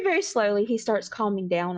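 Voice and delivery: ordinary delivery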